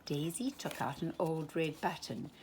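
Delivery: plain reading voice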